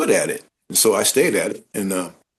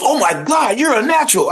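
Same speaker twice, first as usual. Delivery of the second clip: much higher, thrilled casting lady voice; amazed gush; 12 semitones higher than usual. Retold or imitated. Retelling the casting lady gushing over his read, much higher and thrilled. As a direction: gush like she found a star.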